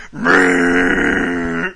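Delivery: loud buzzing engine drone; airplane drone. Retold or imitated. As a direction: hum like a plane engine. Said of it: "That's my impression of an airplane".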